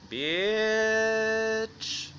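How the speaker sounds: high, sustained single word; long held insult. Acted. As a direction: stretch the vowel out high on one held note. Mock street-tough insult, held.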